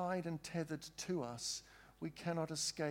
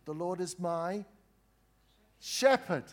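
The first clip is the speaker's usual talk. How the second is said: rising proclamation; 3 semitones higher, 8 dB louder than usual. Shown as a demonstration. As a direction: build from "The Lord is my" to a high, loud "shepherd.". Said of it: "We're going really slowly".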